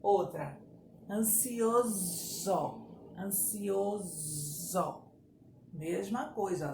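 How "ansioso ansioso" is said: "Ansioso" very slow, the s a long buzzing zzz; drawn-out "Ansioso" with a voiced z; buzzing Z sound. Shown as a demonstration. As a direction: buzz the s in "Ansioso" like a bee.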